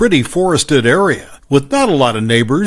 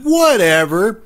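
put-on high, dismissive bratty kid; annoyed whatever; 6 semitones higher, slower than usual. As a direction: toss it off like a sulky teen. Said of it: "annoyed sigh and said".

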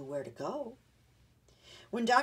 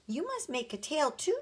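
put-on pleading child; 9 semitones higher, 3 dB louder than usual. A narrator's reading. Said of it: "begged Benny".

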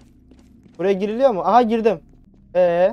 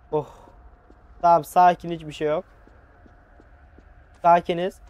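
ordinary delivery

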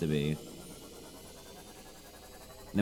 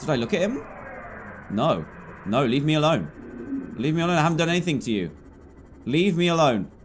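scared pleading; 5 semitones higher than usual. Real, not acted. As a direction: beg it to leave you alone, frightened. Ghost chases the player.

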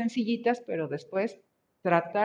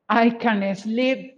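slow model; 2 semitones higher, 3 dB louder, much slower than usual. Shown as a demonstration. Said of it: "teacher models student's sentence".

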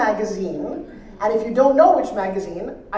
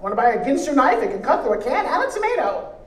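higher, punchy parody of a street pitchman; hawking salesman; 4 semitones higher, 4 dB louder than usual. Acted. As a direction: hawk it like a cheesy infomercial guy. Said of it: "some guy hawking stuff".

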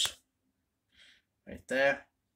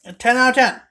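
excited; 9 semitones higher, 10 dB louder than usual. Real, not acted. Enthusiastic rating.

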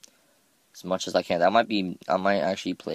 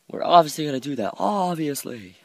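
high, louder mock certainty; hammed-up sarcasm; 9 semitones higher, 5 dB louder than usual. Gamer mocking his own building plans, sarcastic. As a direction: lay the sarcasm on thick, pitched high. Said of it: "my really pathetic sarcasm thing".

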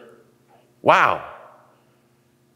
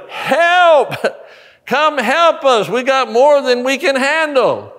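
calling for help; 9 semitones higher, slower than usual. Retold or imitated. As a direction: yell to the other boat for help. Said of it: "Peter's going".

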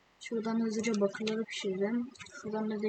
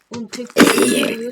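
frustrated outburst; 13 dB louder than usual. Real, not acted. Frustrated during fight.

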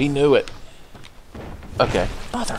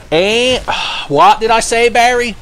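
raised, high-pitched exasperated scolding; mock scolding; 10 semitones higher, 5 dB louder than usual. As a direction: tell Barry off, loud and high. Scolding Barry in the game.

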